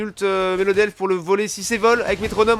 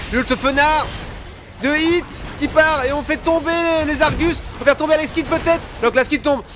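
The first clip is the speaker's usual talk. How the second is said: hyped caster; 7 semitones higher than usual. Real, not acted. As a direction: call the teamfight at full hype. Final teamfight, caster hypes up. (commentateur e-sport surexcité)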